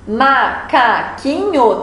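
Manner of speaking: "macaquinho" slowly split into ma-ca-qui-nho; syllable by syllable. Shown as a demonstration. Teacher showing pupils how many syllables a word has, syllable by syllable. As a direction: break "macaquinho" into four separate, drawn-out syllables. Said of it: "tem quatro sílabas".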